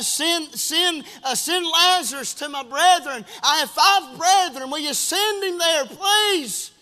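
loud, high begging cry; desperate pleading, acted. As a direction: beg at full voice for your brothers. Rich man begging Abraham.

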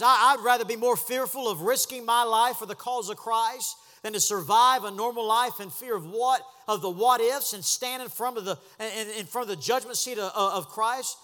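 loud, driving sermon climax; full-force preaching. Acted.

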